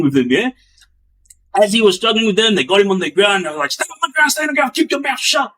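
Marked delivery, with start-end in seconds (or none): 3.7-5.5 high, hammed-up guard commands; mock shouted orders, pitched high; guards yelling. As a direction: bark the orders down at him.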